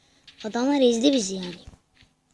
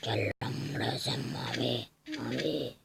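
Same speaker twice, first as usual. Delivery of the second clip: mock menace; 11 semitones lower, 9 dB quieter, slower than usual. Threatening blue team.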